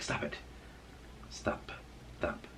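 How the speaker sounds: very soft, short commands; hushed scolding aside. Real, not acted. Owner interrupting talk to check pet, hushed scolding. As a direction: quietly tell a pet off mid-thought.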